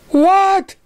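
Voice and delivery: shrill high shocked what; disbelief. A narrator's reading. Surprise stunned by the accusation, shrill. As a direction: shriek it, stunned. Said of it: "said Surprise in disbelief".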